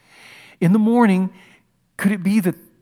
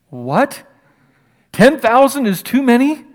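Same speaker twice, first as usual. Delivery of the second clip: higher and louder, incredulous; loud disbelief; 6 semitones higher, 6 dB louder than usual. Real, not acted. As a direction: throw the question out in shock. Preacher voicing incredulity at God.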